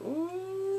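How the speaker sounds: high, drawn-out ooh; teasing coo. Real, not acted. Vlogger sees them all alone, teasing coo. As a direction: coo at the lovebirds.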